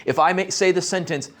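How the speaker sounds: ordinary talk, nothing marked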